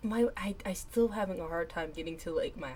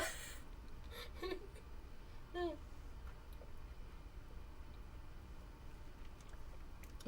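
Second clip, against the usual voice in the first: helpless wheezing laughter; 12 semitones higher, 12 dB quieter than usual. Real, not acted.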